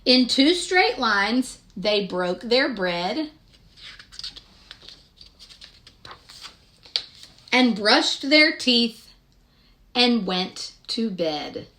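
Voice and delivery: gentle, sing-song picture-book narration; storybook reading. Acted. Reading Madeline aloud to young children, sing-song storytelling. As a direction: read the rhyme aloud to little listeners.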